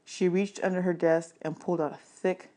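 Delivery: ordinary delivery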